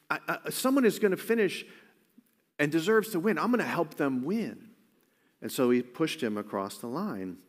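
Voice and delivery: usual speaking voice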